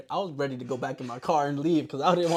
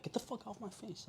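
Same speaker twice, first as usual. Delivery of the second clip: soft, higher-pitched mock annoyance; mock brush-off; 7 semitones higher, 16 dB quieter than usual. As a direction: brush them off under your breath. Imitating playful shove.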